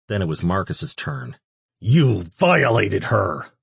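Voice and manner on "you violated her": slow, loud accusation; accusing. A narrator's reading. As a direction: hurl the accusation slowly. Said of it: "Ash accused the demon".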